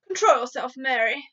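loud, sharp order; sharp rebuke. Acted. Queen sharply rebuking her panicking maid, loud. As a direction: snap her back to order. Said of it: "the Queen said sharply".

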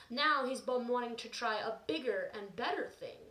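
normal talking voice

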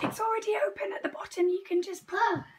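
quick high whisper; voiceless, hurried whisper; raspy whisper. Real, not acted. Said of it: "I'm losing my voice".

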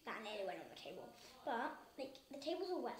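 ordinary talk

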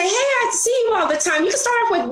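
very high bright hello; mock sunny opener; friendly greeting. Shown as a demonstration. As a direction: greet a regular cheerfully. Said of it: "look who's around you and say".

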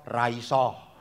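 low, deadpan refusal; curt written reply. Retold or imitated. Pastor answers his colleague's order, low deadpan refusal.

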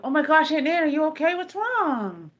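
high, alarmed call to her aunt; anxious high-pitched concern; worried call. Retold or imitated. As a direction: sound scared for Aunt Nan. Checking on screaming aunt.

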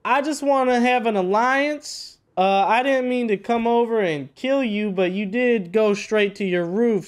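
put-on southern accent to disguise the voice. Player pleading with neighbors for an alliance, southern accent. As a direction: do a folksy southern accent, pitched a little up. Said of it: "talk in my southern accent".

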